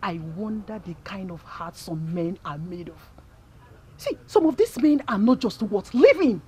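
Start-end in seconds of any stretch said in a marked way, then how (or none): 4.0-6.4 indignant. Acted. Friend outraged at men.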